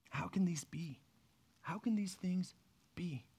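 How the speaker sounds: whispered, baffled asking; hushed bewildered question. Retold or imitated. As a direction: breathe the question out in disbelief. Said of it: "I picture him sitting there and going".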